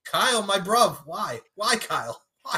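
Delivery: high, whiny mock London plea; mock hurt London mate. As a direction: ham up a betrayed London mate.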